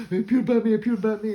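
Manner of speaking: high, loud whining; whiny complaint. Retold or imitated. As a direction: whine like a bitten grown man. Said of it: "and he's like".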